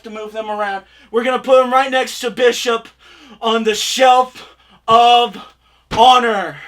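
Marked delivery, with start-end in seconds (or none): none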